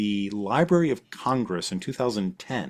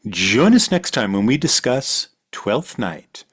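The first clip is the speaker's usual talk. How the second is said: slow, lower promo voice for the listeners; announcer reading the channel plugs; podcast outro read; 4 semitones lower than usual. Acted. Host reads the outro ad to listeners, smooth announcer delivery.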